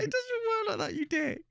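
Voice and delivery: high, teary laughing words; cracking up while scolding. Real, not acted. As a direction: still laughing, mock-scolding the son. Laughing at son's logic.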